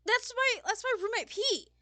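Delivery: high, very loud, gushing; excited shout. Acted. Sullivan telling Paige about the roommate, excited shout. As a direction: blurt it out high and loud, thrilled.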